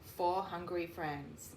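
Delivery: ordinary delivery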